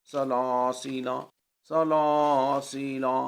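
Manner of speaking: measured Quranic reading with long vowels in سلاسیلا; drawn-out vowels. Shown as a demonstration. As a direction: recite سلاسیلا slowly, holding "laa", "see", "laa". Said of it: "اسی طرح سلاسیلا". (سلاسیلا کے الف کھینچ کر، ٹھہر ٹھہر کر)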